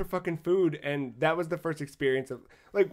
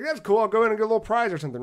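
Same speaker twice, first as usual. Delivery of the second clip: higher, louder, quick burst of keenness; eager reaction; 7 semitones higher, 6 dB louder, much faster than usual. Retold or imitated. Traveler planning to get a little prize, eager. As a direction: retell it with upbeat eagerness.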